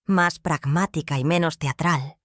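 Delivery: plain reading voice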